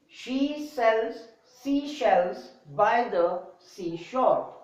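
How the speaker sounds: slow, even, word by word; slow careful drill reading; slow tongue twister demo. Shown as a demonstration. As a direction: read it slowly for students. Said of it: "in a slow rhythm".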